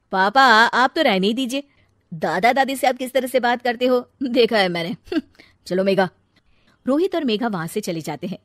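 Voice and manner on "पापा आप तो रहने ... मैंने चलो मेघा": retorting. Acted. Son retorts to father.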